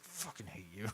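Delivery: low, very soft resentment; hushed comic grudge; acted grumble. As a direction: say it under your breath. [name] grumbles at Monty after protest.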